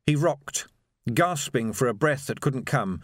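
usual speaking voice

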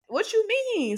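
sharp jump way up in pitch; incredulous. Retold or imitated. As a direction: disbelief at the offer of a shot. Offered a shot at the salon.

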